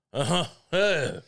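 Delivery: light, casual celebrity impression; a hint of impression, drawn out at the end; Robert De Niro. Comic imitating an actor urging a vote on stage, light De Niro impression. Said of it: "that's the Robert De Niro impression".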